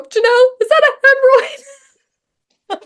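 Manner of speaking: high, loud comic panic; mock alarm. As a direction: shriek the question in hammed-up alarm. Retold alarmed question, played comically.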